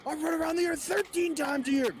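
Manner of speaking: high, loud mock Goggins; Goggins impression. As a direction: brag it in a shouty voice.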